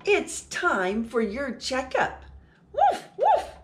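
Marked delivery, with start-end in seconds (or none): 2.7-3.5 high, loud playful puppy woof; puppy barking. Acted. Teacher reading to children, barking as the puppy: high loud woofs. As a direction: bark like an excited little dog.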